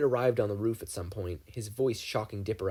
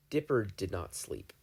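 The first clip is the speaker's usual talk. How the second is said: plain reading voice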